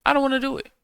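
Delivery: high, whiny put-on voice; lightly voiced whiny refusal; mock refusal. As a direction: act out the simple no he could have said. Models the refusal he should give.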